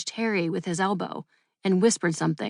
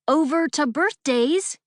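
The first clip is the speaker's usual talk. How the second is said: higher, slow loud call; shouted; 7 semitones higher, 3 dB louder than usual. A narrator's reading. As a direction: shout the cue across the studio. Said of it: "Damien hadn't shouted".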